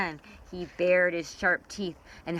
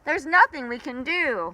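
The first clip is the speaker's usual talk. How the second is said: frightened creatures; 7 semitones higher, 3 dB louder than usual. Acted.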